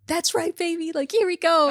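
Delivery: high, hyped-up retold cheer; reenacting a thrilled movie-goer's reaction; excited cheering. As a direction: cheer it on with a laugh. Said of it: "I literally clapped and I was like".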